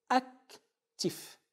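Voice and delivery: "Actif" broken as "ac", pause, "tif"; high "ac" held apart from "tif" in "Actif"; syllable by syllable. Shown as a demonstration. Teacher gives another double-consonant example, split into syllables. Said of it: "La coupe s'établit ainsi".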